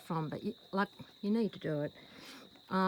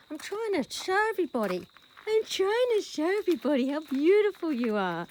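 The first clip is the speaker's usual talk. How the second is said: doting; 6 semitones higher, 7 dB louder than usual. Real, not acted. Doting talk to the horse.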